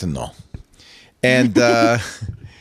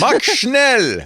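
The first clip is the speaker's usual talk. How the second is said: higher, barked mock German command; mock German guard; 9 semitones higher than usual. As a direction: snap the order like a stage German guard. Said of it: "Can you be Achtung?".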